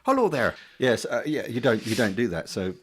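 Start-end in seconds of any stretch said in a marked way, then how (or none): 0.0-0.5 high, loud greeting in a put-on voice; a comic character popping in; silly character voice. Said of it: "it doesn't come in and go".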